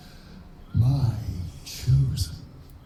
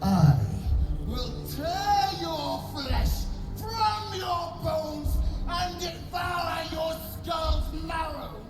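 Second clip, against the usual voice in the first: high furious shouted threats; 8 semitones higher than usual. Acted. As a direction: scream threats of devouring. Queen threatens, game scene.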